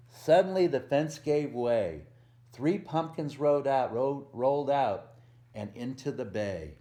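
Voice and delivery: lively picture-book read-aloud with a bouncy rhythm; reading to children. A narrator's reading. Volunteer reading a Halloween book to kids, storytelling voice.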